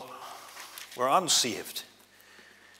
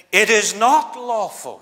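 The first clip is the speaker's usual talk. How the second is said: loud, slow, lofty posh voice; a hint of a snooty posh Pharisee; posh accent; 7 semitones higher, 9 dB louder than usual. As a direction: look down your nose at it. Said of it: "putting on that posh accent".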